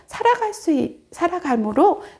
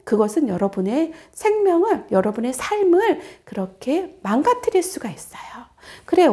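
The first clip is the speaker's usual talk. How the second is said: normal talking voice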